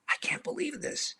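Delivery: hushed, breathless whisper of disbelief; awed whisper. Retold or imitated. Director stunned watching the crowd, whispering in awe. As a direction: breathe it out, barely voiced. Stunned aside at packed premiere.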